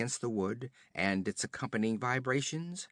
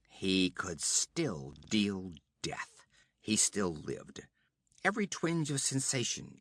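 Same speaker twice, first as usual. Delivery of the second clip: plain reading voice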